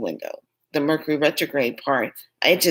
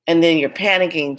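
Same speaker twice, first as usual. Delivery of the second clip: ordinary delivery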